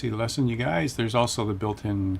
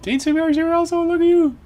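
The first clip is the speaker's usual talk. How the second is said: much higher and louder, gushing; delighted squeal; 8 dB louder than usual. Real, not acted. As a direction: squeal with joy at a friend. Delighted to see a viewer.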